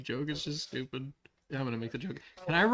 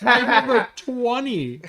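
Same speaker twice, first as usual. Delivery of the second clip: loud lament; 9 semitones higher, 14 dB louder, slower than usual. Real, not acted. Player moaning about low skill.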